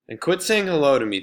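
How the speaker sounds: angry. Acted. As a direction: tell them off sharply. Jeff angrily continuing.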